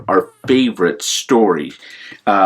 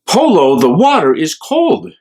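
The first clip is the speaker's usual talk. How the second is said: louder, higher cautioning moose friend; voicing the moose; 6 semitones higher, 5 dB louder than usual. Walter the moose warns Polo about the cold water, raised. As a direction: call out a friendly warning.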